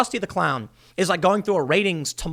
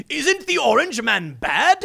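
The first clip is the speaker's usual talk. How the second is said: slow, higher, smug mock host voice; mocking John Oliver; 7 semitones higher, slower than usual. Mocking a late-night host's smug jab at Trump, slow and higher. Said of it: "John Oliver going".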